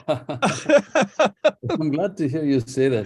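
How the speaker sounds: laughing it out slowly, pleased. Real, not acted. Author laughs at host's praise of his accent, laughing. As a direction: chuckle through the words, flattered.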